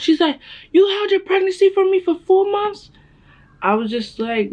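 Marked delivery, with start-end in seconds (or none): 0.7-2.9 very high, louder outraged question; imitated indignant shock. Daughter mimics mom learning of four-month secret, high indignant shock.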